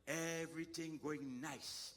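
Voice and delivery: slow, stretched-out storytelling; drawn out. Retold or imitated. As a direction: stretch every word at an easy, level pace. Storyteller draws out the smooth progress.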